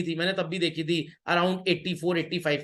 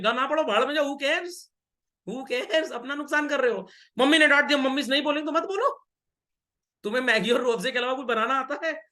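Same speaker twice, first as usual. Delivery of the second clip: raised, high-pitched exasperated telling-off; mock scolding; 6 semitones higher than usual. Teacher snaps back at a sulking pupil, mock angry and raised. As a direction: scold the kid like a fed-up parent.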